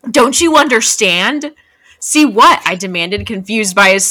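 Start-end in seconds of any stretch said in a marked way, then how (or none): 0.1-2.6 dramatic reading. Acted. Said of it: "and Edward says".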